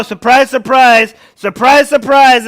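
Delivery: loud, sing-song mock surprise; sarcastic surprise. Real, not acted. Streamer mocking the shocked losers, loud sarcastic sing-song. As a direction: throw each surprise out sarcastically. Sarcastic about predicted outcome.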